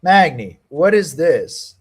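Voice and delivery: loud frustrated yell. Real, not acted. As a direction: yell at the fighter on screen. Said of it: "sorry if that was loud".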